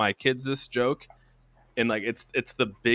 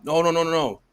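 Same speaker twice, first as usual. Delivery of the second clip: higher, rapid string of refusals; insistent denial; 5 semitones higher, 4 dB louder than usual. Acted. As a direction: protest in a rush, pitched up. Soldier protests in acted joke.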